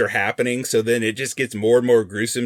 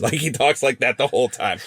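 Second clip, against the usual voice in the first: ordinary talk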